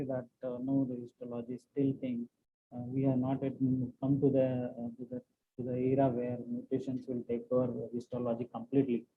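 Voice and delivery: speaking through a covered mic, dull and muffled. Real, not acted. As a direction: voice muffled as if behind a hand. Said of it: "your voice is muffled".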